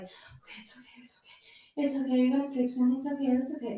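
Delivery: whispering to calm herself, breath held; soothing self-whisper. Retold or imitated. Talking herself down on the surgery chair, whispered. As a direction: hush yourself through the fear. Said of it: "I was like".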